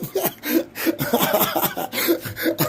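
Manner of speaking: breathy high-pitched cackle; helpless laugh; high wheezing laugh. Real, not acted. Host cracking up over the yellow pages bit, high wheeze.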